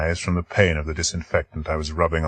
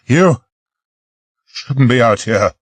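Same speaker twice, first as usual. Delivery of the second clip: scolding; 5 semitones higher, 4 dB louder, slower than usual. Acted. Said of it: "I scolded".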